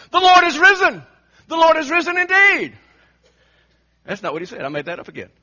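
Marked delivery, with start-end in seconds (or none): 0.1-2.8 much higher and much louder, ringing out; triumphant shout. Acted. Thomas wrongly imagined answering the disciples, with a triumphant shout. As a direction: proclaim it like an Easter cry. Acted triumphant Easter proclamation.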